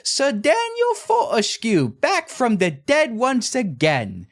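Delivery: very high, slow, hammy British ghost yell; over-the-top British announcer voice, drawn out; obnoxious British ghost. Retold or imitated. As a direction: proclaim it like a pompous British ghost. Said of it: "obnoxiously British ghost yells".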